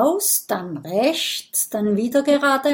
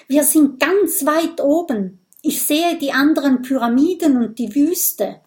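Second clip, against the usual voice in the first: higher, louder, excited lookout; raised voice of discovery; excited report; 5 semitones higher, 4 dB louder than usual. A narrator's reading. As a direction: call back the view, thrilled. Character reports the view through gap.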